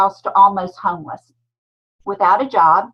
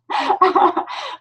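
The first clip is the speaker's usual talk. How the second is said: bright high laugh; high giggle; 11 semitones higher than usual. Real, not acted. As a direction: giggle at your own joke. Laughs at own joke.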